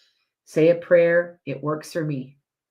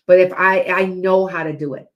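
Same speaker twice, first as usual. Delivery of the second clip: usual speaking voice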